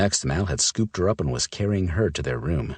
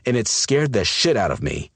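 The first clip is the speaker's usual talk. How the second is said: louder, urgent, stressing "shit"; raised voice; 7 semitones higher, 5 dB louder than usual. Acted. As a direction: blurt out "shit" hard. Mal's raw outburst.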